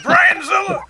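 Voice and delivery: playful shout. Real, not acted. Co-host crowning Brian a monster, playful shout. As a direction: yell it like a monster title.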